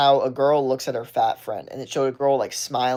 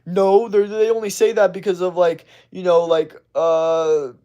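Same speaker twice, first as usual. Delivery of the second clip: higher, louder flustered defensive mock; mock defensive rant; 4 semitones higher, 5 dB louder than usual. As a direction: sputter like a cornered arguer. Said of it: "say defensive things like".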